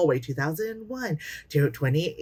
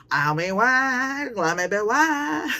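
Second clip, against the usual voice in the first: put-on whiny teen; 6 semitones higher, slower than usual.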